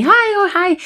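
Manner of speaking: high, sing-song hello; chirpy acted greeting; friendly. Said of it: "I'm just friendly".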